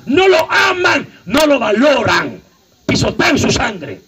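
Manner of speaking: slow, high, shouted denial; emphatic preaching shout. Real, not acted. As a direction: hammer each no out slowly. Preacher denouncing false love.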